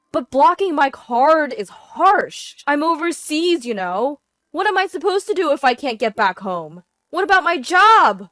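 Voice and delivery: loud, high-pitched complaint; husband wailing about his troubles; panicked protest. Acted. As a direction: protest as if stranded and frantic. Stranded husband protests blocked card.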